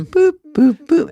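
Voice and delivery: high, loud boops; arcade beeping imitation. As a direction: mimic the Asteroids game beeps. Imitating Asteroids game sounds.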